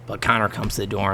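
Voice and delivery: ordinary talk, nothing marked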